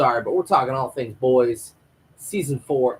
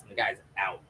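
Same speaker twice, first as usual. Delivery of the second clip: ordinary talk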